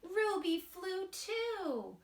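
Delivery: slow, sing-song storybook reading; gentle storybook narration with light character voices; reading to children. A narrator's reading. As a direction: read it aloud to a group of little kids. Reads picture book to children.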